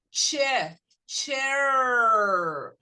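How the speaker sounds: "Che, chair" loud, crisp CH and long held R; hard English CH. Shown as a demonstration. Teacher drills the right sound, "Che, chair" loud and stretched. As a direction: punch "Che", then draw out the R of "chair". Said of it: "você tem que acostumar a falar".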